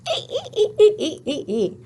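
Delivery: high-pitched "ee-ee-ee" giggle falling away; Happy Y's giggle. Acted. Teacher voicing a story character's laugh, high giggle. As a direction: giggle brightly on a repeated long E. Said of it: "What did she say?".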